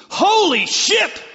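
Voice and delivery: very high, loud, slow drawn-out yell; loud startled yell. Retold or imitated. Son yelling from the basement at a TV twist, very loud, high yell. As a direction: belt it out as loud as can be. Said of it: "as loud as can be".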